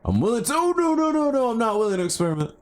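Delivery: high-pitched rush of alarm; panicked backtracking. Real, not acted. Player starting to fall changes their mind, panicked. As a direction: squeal the refusal as panic hits.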